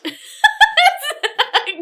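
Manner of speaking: high loud real laugh; burst of laughter. Real, not acted. Reader bursts out laughing at her own line.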